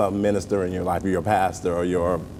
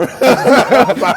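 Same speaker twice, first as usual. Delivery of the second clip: burst of laughter; 9 dB louder than usual. Real, not acted. Laughs at rival college joke.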